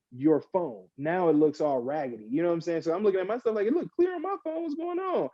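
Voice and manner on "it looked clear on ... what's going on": high, puzzled complaint; high-pitched mock bewilderment; bewildered confusion. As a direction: act baffled at your own blurry video. Retelling confusion at blurry video.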